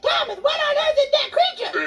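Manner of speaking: high, alarmed shout at the creature; startled shout. Retold or imitated. Wife cries out to her husband at the monster, startled shout. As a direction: cry out in fright from the boat. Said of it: "I jumped up in the boat and I said".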